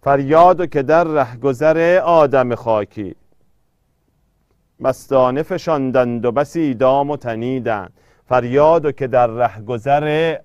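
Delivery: emphatic verse reading with the metre marked; strong, measured recitation; poem recitation. Acted. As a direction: declaim the couplet, long syllables held. Recites a couplet for scansion.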